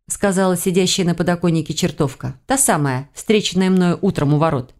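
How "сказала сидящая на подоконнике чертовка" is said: plain reading voice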